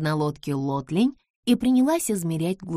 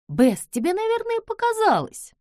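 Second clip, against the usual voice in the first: skeptical friend; 3 dB louder than usual. Acted. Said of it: "сказала Джордж".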